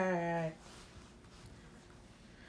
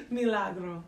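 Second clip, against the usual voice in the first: Spanish accent; 3 semitones lower, 4 dB quieter than usual. Shown as a demonstration. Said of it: "Yeah, see? That sounded good".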